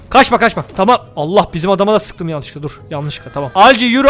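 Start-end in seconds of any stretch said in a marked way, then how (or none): none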